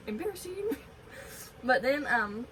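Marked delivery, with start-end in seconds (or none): 0.0-0.8 laughing. Real, not acted. Laughs at own pee spill.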